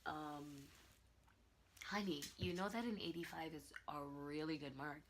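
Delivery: calm the student down like a kind teacher; teacher's soft reply. Retold or imitated. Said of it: "She's like".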